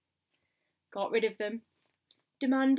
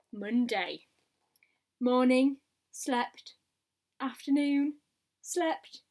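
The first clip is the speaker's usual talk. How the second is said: ordinary delivery, nothing marked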